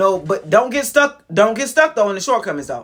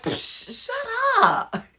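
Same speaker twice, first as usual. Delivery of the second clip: dismissive scoff; 14 semitones higher, much slower than usual. Retold or imitated. Dismissing the victim whine.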